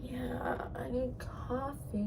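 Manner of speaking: sleepy, breathy little whisper; tired whisper. Real, not acted. Tired during all-nighter.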